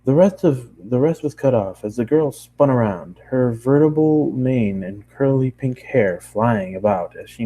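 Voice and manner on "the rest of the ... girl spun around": ordinary delivery, nothing marked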